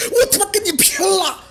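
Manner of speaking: high desperate battle cry; furious shout. Acted. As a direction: scream it as you charge. Desperate charge at enemy.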